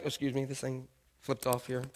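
soft, low aside; quiet aside. Real, not acted. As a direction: drop to a soft, low murmur of apology. Fixes mic, aside to the room.

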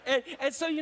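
high, loud laughing talk. Real, not acted. Pastor laughing at the memory with his congregation, laughing. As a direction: laugh through the words.

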